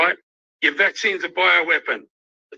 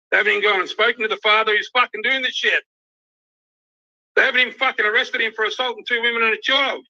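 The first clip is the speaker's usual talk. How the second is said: higher-pitched, indignant rush of words; real outrage; 5 semitones higher, faster than usual. Real, not acted. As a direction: protest in raised pitch, genuinely outraged. Outraged rant about police inaction.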